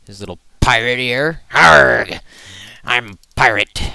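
loud, hammy pirate growl; terrible pirate impression. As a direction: ham up a bad pirate voice. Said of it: "That was a terrible pirate impression".

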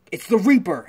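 loud, high cry of alarm; alarmed shout. Acted. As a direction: call out a sighting in sudden alarm. Guards spot the villain, alarm.

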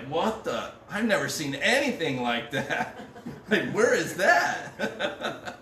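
incredulous, breaking into laughter; mock amazement. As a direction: act astonished, pitch climbing on the last question. Mimics viewer amazed by TV commercials.

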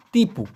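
তিপু with soft dental t, not hard টিপু; dental t in tipu. Shown as a demonstration. Teacher showing Malay t sound, তিপু dental. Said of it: "টিপুর উচ্চারণ হবে".

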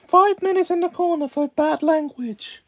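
loud prim scolding; prim schoolmarm. Acted. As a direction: scold like a strict governess.

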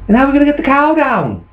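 very quick, high-pitched question; exasperated fussing parent. Acted. Parent asking about the stranded cow, fast and exasperated.

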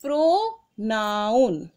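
slow, teacherly answer; slow drawn-out answer. Shown as a demonstration. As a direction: stretch the word out slowly for the class. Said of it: "क्या है?".

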